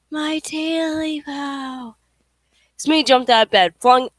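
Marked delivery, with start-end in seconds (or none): 0.1-1.9 slow, high, breathy eerie whisper; slow ghostly whisper. Acted. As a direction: let the ghost's call die into a whisper.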